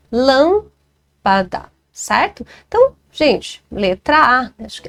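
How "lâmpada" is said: "lâmpada" very slowly, long "lâm", pause, "pa-da"; syllable by syllable. Shown as a demonstration. Teacher showing the antepenultimate stress in "lâmpada", syllable by syllable. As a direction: sound out "lâmpada" with the first syllable held. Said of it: "A antepenúltima sílaba".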